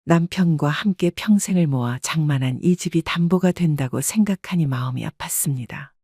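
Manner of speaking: usual speaking voice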